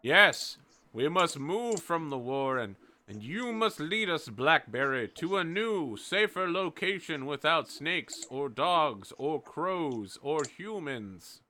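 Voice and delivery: hammed-up crowd voice drawing out the last words; rabbits agreeing. The warren urging Blackberry to lead them, lightly voiced crowd. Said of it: "everyone's like".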